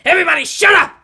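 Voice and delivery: loud, high shout; robber shouting. A narrator's reading. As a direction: yell everyone down, desperate. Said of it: "the robber shouted".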